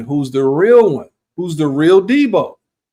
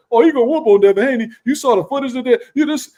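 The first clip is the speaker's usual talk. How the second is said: mock hyped fans; 10 semitones higher, faster than usual. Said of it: "everybody's gonna fall into play".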